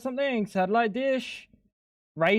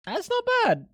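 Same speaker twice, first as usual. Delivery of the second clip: squeaky high exclamation. Acted. Streamer reacting to a food picture, high squeaky mock voice. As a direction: pitch it way up into a squeak. Same words said twice.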